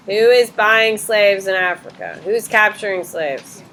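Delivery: ordinary talk, nothing marked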